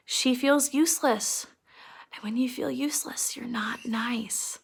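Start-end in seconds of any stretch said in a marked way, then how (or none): 2.1-4.6 whisper. Real, not acted. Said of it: "teacher drops into hush".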